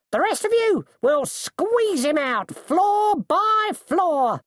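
very high shout, slowing at the end; rally the men, stretching out the final threat; coachman's high, menacing command; shouted orders. A narrator's reading.

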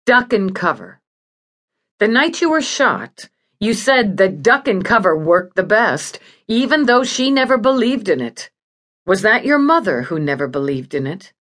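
plain reading voice

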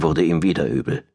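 plain reading voice

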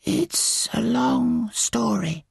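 high, drawn-out bird voice; slow caterbird. Acted. Caterbird stalling before explaining, slow high voice. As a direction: stretch each word out, pitched high. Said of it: "he said slowly".

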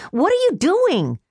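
alarmed. Acted. Sister advancing to choke him.

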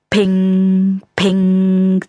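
high ringing ping sound; ping noise. Acted. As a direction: chime it like a metal ping. Same noise again. (helles Ping-Geräusch)

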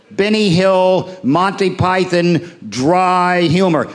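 each name drawn out slowly; slow listing. Shown as a demonstration. Comic mocking what Americans love, listing each name slowly. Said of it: "that's what they love".

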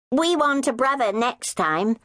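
put-on child announcing. Little girl announcing her wish to the sisters. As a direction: declare it proudly like a child. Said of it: "announced Vanessa".

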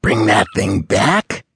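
ordinary delivery, nothing marked

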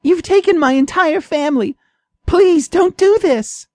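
a frightened mouse begs the cat to spare her; pleading mouse. A narrator's reading. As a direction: plead for your life as the tiny mouse. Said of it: "Jenna begs for her life".

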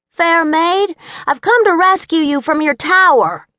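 put-on high, loud little-boy knight; child hamming up a chivalrous greeting; gallant boy knight. A narrator's reading. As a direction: play the brave rescuer.

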